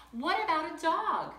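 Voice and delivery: spring the question eagerly; catching parents off guard, eager; eager girl. Acted.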